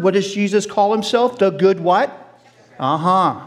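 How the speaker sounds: normal talking voice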